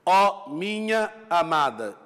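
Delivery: slow, drawn-out reading of a line of verse; slow lyrical reading; verse recitation. Shown as a demonstration. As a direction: recite it like a poem, lingering on each word. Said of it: "E neste caso, estão a ver".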